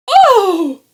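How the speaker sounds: shrill high scream. A narrator's reading. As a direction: let out a terrified scream. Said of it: "her mom screamed".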